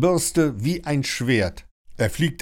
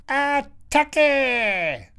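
high, long-drawn croaking cry; stretched high squawk, falling at the end; parrot battle squawk. A narrator's reading. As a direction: screech the war cry like a parrot. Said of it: "krächzt er".

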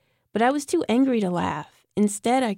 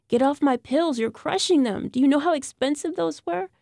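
higher, sharper angry scold; 7 semitones higher than usual. A narrator's reading. Mother scolding daughter for crushing her pills, angry.

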